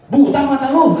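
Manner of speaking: high, loud mock yell of a blind man; mock angry yell. Blind man yelling at the one who bumped him, hammed-up angry. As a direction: snap at him in a hammed-up rage. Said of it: "Lalu marahnya gimana kira-kira?".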